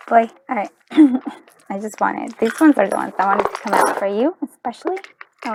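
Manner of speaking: normal talking voice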